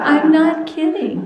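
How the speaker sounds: loud, high laughing through the words; laughing delight. Real, not acted. Telling the audience about her ninety-ninth percentile, laughing loudly. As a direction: burst out laughing with joy.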